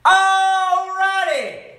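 high, loud, long-held bellow. A narrator's reading. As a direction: stretch it into a huge holler. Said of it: "he bellowed so loud".

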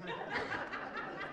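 breathy delighted giggle; soft high laugh; airy high laugh. Real, not acted. Delighted at the principal's quote.

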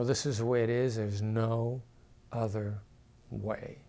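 each word falling, pausing and fading low; final, closed downward tone; downward inflection. Shown as a demonstration. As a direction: drop the pitch firmly on every phrase. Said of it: "I will say it exactly like that".

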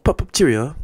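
loud, high hammed-up British toff voice; mock British accent. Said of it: "I don't think they say that".

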